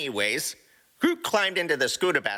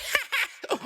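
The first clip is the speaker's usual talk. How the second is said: high squealing giggle; 3 dB quieter than usual. Acted. Rocket laughing at the shoppers, high giggle.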